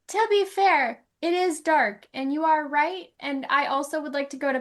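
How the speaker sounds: playful dog voice; dog's voice. Pretend. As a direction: answer back as the hungry dog. Voicing the dog replying.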